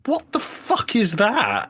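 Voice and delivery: high, slow, disbelieving outburst; incredulous. Real, not acted. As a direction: stretch it out in disbelief. Exasperated rant.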